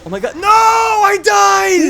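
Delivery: high loud dismayed shout. Real, not acted. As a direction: yell out in real dismay. Real death in game.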